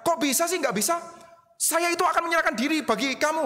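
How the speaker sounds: Peter objecting. Acted. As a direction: protest that you surely can.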